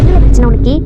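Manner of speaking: very loud confrontational demand; angry shout. Acted. Woman confronting the man in the quarrel, angry shout. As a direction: round on him at full volume.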